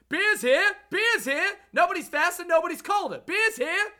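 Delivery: very high, very loud hawker cry; stadium vendor hawking. Shown as a demonstration. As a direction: yell it across the stands like a beer vendor. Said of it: "my signature call out would be just the classic".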